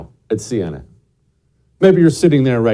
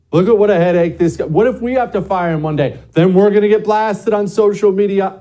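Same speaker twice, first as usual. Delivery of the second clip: higher, louder mock alarm; fretting employer; 8 semitones higher, 4 dB louder than usual. Potential employer reading a candidate's posts, mock alarmed. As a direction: fret aloud as a put-off hiring boss.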